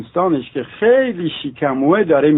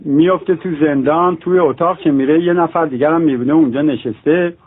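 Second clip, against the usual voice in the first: normal talking voice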